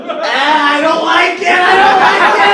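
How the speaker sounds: high, loud whiny squeal; squeamish shriek. Retold or imitated. Touching the cold body again, he shrieks high and loud. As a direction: squeal it like you can't bear it. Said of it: "And I just go".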